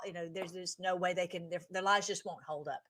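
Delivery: usual speaking voice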